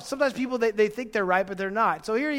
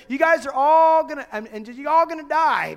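shouted threat, high and loud; retold scream; 7 semitones higher, 8 dB louder than usual. Envoy screaming threats at the people on the wall. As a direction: yell it so the whole wall hears. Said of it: "he starts screaming so they can all hear it".

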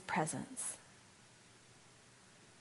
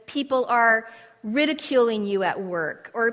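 louder, emphatic sermon exhortation; preaching; 3 semitones higher, 4 dB louder than usual. Acted. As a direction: urge them to stand firm. Preaching with force.

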